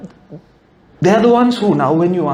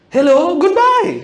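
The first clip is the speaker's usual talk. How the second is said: higher mock-cheery greeting; a hint of a chirpy caller; 11 semitones higher than usual. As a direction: sing it like nonsense. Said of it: "That's like saying".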